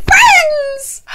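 squealed high, excited. Real, not acted. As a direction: squeal the word with joy. Gushing about best friends.

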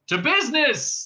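loud, cheery toast. A narrator's reading. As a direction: raise a glass jovially. Ford and Zaphod toast.

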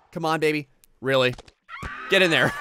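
ordinary delivery

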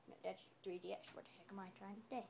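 very soft, low mumble; muttering to self. Pretend. Losing the thread between shouts, low muttering. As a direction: drop right down and mutter to yourself.

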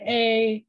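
"A" said as the letter name, ay; long A. Shown as a demonstration. Teacher ruling out another vowel, a long ay. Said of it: "it's not an A".

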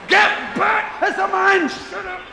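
high, hammed-up panicky shout; yelling parent. Parent shooing wee heads from the open door, shouting. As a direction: shriek the kids back from the door.

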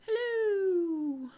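high, loud greeting to a brush; sing-song hello. Pretend. Vlogger greets her brush in a high sing-song hello. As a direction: greet it like an old friend.

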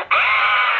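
sudden high yelp; high panicked ah; flustered yelp. Acted. Clerk flustered, sudden cry.

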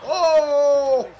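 sudden high, loud cry of surprise; excited yelp. Real, not acted. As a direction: yell it like you can't believe the shot. Reacting to a wild finish.

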